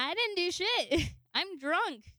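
high-pitched, swooping mock-drunk protest; carefree drunk. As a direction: retell it as a giggly, carefree drunk. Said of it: "I'm just having a fun time".

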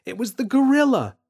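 yelled. Acted. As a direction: kid protesting his innocence. James protests.

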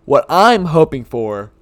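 words stretched through a yawn; slow, drawn-out yawning talk. Real, not acted. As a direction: yawn halfway through the phrase. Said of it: "I'm so sorry for that yawn".

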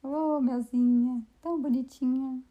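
put-on slow, cooing baby talk; baby talk to dog. Shown as a demonstration. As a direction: coo at the puppy like a baby. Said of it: "E eu falo assim com ela, com essa voz".

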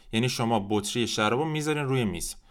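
ordinary talk, nothing marked

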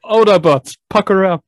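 loud heroic rallying command; booming robot leader. Pretend. Joke mimicking Optimus Prime's rally call.

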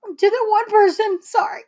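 high, tearful voice; choked-up thanks, pitched high; trying not to cry. Real, not acted. Daughter thanking Mom for Mother's Day, holding back tears. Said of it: "I'm trying not to cry".

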